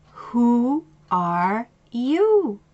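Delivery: each word drawn out slowly, weighted; slow pointed question. Retold or imitated. Coach to angry client, slow and pointed. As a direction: make her stop and think, slowly.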